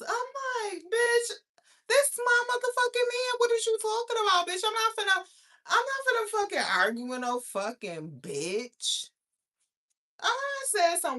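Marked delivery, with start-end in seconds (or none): 0.8-9.1 high, loud quarrel shouting; re-enacted screaming match; furious comeback. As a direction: yell over the other woman. Shouting back in a retold quarrel.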